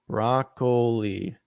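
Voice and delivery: "Broccoli" slowly as brock-OH-lee; alternative pronunciation. Shown as a demonstration. Reader tries out the surname, "Broccoli" slowly sounded as brock-OH-lee. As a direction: draw out "Broccoli" in three syllables with a long OH. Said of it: "Please tell me it's pronounced broccoli".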